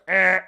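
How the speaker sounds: a tight throaty honk from the throat; honk noise. Shown as a demonstration. Teaching viewers the Kermit base, a honk noise. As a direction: squeeze out a short loud honk. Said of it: "Just go".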